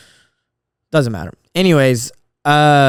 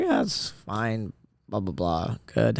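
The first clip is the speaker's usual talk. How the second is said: bored brush-off; 3 semitones lower, 8 dB quieter, slower than usual. Retold or imitated. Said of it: "And she was like".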